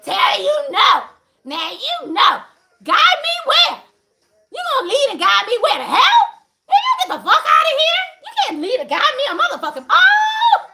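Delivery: loud, high laughing yells; fired-up laughing shouting; laughing heated shouts. Real, not acted. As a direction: blow up at the idea with laughter. Heated rant about dude leading.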